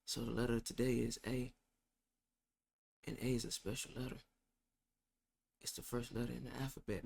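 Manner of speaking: very low, hushed mumble; barely audible judge. Acted. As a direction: mimic a judge nobody can hear, soft and low. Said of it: "He talks like this".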